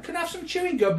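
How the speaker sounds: put-on child asking parent for gum; whiny kid. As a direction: beg like a kid in the back seat. Acted child in car scene.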